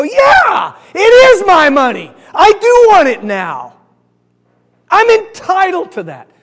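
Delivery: loud, high, drawn-out declaration; mock-entitled shout, stretching the words; emphatic agreement. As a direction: holler each claim like you fully believe it. Acts out viewer agreeing with commercial.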